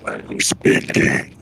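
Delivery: low, slow gravelly growl; gravelly Batman voice. Acted. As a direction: growl it like a brooding vigilante. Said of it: "in the most gravelly Batman voice possible".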